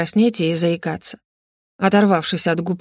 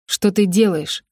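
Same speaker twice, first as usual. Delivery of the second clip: usual speaking voice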